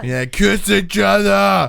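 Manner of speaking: high, loud, slow holler; playful full-voiced crowd shout; re-enacted yell. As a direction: yell it drawn out like an egging-on fan. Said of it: "we were all yelling".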